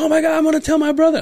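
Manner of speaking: fast, very high excited voice; imitating a high-voiced man bursting to share news; Jerry. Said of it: "Doesn't he have the high voice?".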